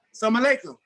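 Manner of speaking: calling at the gate to an empty house; calling a greeting. Retold or imitated. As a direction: call toward the house.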